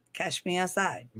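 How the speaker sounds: soft, offhand catchphrase; understated mock challenge; catchphrase quote. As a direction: toss off the catchphrase softly. Quotes a catchphrase, first take.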